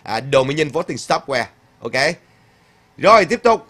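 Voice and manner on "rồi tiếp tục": raised, high call to move on; loud cheerful cue. Real, not acted. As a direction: call out the next item to the chat. Host moves to next comment.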